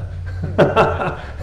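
a quick airy laugh up high; a real laugh, mostly breath; high breathy laugh. Real, not acted. Laughs at his own bit.

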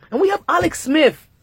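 put-on tossing off a playful British accent; little British accent. To viewers about Alex Smith, a light mock British accent. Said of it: "I just came up with a little British accent".

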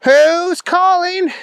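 very high, drawn-out cartoon voice; Booger McFarland. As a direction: answer slowly in a high, goofy voice. Booger questions the caller.

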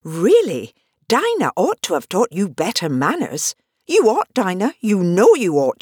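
usual speaking voice

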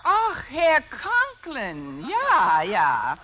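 put-on slow, drawn-out German accent; hammy German accent, very slow. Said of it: "If I can do a decent German accent".

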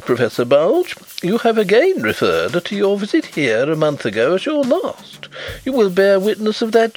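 boiling over. A narrator's reading. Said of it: "now he boiled over".

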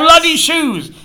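high, loud indignant shout; exasperated outrage. Acted. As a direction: spit out the accusation loudly. Pieces together the betrayal.

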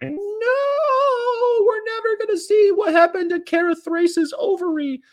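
very high anguished fan wail; shrill wail. Retold or imitated. Retold fan outcry.

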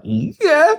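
put-on a meek little voice of a lost girl; a high, timid "Yeah"; tiny lost yes. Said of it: "I was like".

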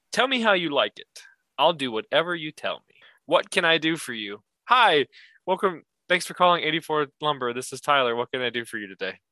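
chirpy call-center script; sales voice. Pretend. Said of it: "this is my sales voice".

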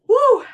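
high, loud "woo"; whoop. Real, not acted. Coach cheering in a workout, loud high whoop.

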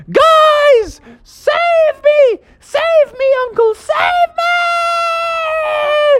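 very high acted scream for help; hollering for help. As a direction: holler desperately, stretching the last cry. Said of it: "So she hollered out again".

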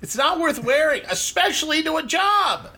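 ribbing a friend about dress code; mock lecture. Real, not acted. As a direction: lay down the rule laughing.

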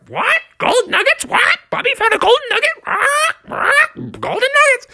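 very high excited bird squawks; squawking birds. Acted. Birds learning of Bubby's find, squawking high. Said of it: "the birds were squawking".

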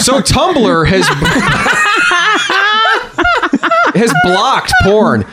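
slow, breaking into laughter; laughing. Real, not acted. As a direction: laugh through the words. Laughs mid sentence.

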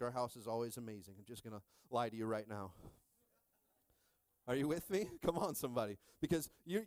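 ordinary talk

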